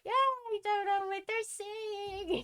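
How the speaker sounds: high, drawn-out singsong helplessness; mock cluelessness. Sitting there while they talk Spanish, mock helpless whine. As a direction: stretch it slowly up high, playfully lost.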